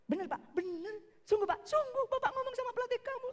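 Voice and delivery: put-on high, rising excited boy; can't believe the good news; delighted disbelief. Son asking his father if it's really true, high and excited. Son hearing he can be goalkeeper.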